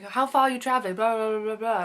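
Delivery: lightly mimicked enthusiast. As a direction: lightly mimic an eager bike man. Imitating the bike man's questions.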